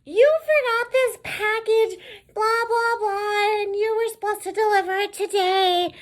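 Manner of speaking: very high falsetto scolding; screechy caricature of a nagging boss; mocked nagging supervisor. Supervisor on the phone about a forgotten package, mocked shrieky nagging. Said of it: "And she's like".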